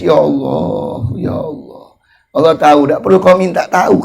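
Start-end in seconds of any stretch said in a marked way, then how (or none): 0.0-1.8 tearful prayer. Shown as a demonstration. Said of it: "Bila perlu nangis".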